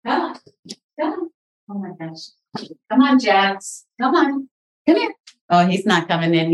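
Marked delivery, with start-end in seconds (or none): 0.1-1.3 high coaxing call to a pet; sing-song higher call, coaxing the dog in. Real, not acted. Owner calling her dog at the door, high and coaxing. 2.9-5.1 high coaxing call to a pet; sing-song higher call, coaxing the dog in. Real, not acted. Owner calling her dog at the door, high and coaxing.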